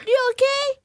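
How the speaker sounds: put-on high little-kid voice; high-pitched childlike question. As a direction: ask it as a small child would. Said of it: "like a kid".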